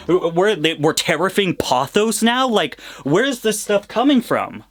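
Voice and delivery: rising, slowed disbelief; can't believe the price; outraged disbelief. Real, not acted. Rant about pothos prices.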